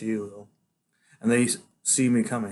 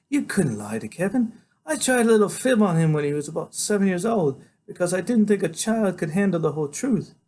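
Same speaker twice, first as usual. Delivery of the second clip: higher-pitched, gently reproving voice; doctor setting a boy straight, voice lifted; earnest woman doctor; 8 semitones higher than usual. A narrator's reading. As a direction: explain kindly but firmly, pitched up.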